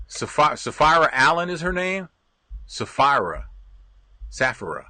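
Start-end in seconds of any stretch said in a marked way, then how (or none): none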